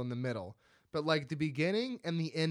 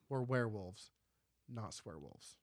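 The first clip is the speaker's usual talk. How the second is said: a hint of a low, softer film voice on swearwolves; quoted film line; 6 semitones lower, 7 dB quieter than usual. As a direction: drop low and soft for the punchline. Quoting the film's famous line.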